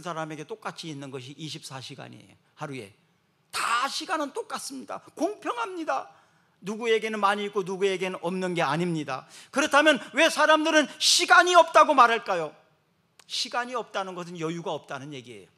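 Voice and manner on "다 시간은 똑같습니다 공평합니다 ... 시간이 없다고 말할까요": higher, louder emphatic proclamation; forceful pulpit delivery; preaching at full force. Acted. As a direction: drive the point home.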